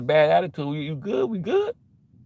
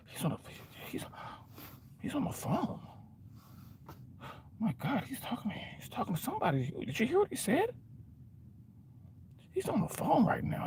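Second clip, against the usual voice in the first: hushed nosy onlooker; 7 semitones higher, 16 dB quieter than usual. Retold or imitated. Bystanders whispering about a man on his phone, high and hushed. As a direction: lean in and whisper like a nervous bystander.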